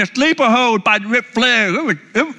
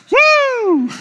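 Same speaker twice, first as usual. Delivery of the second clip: Ric Flair woo. Retold or imitated. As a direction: whoop like the Nature Boy. Showing off Flair's belt.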